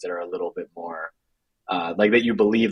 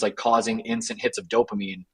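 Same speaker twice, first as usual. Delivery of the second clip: ordinary talk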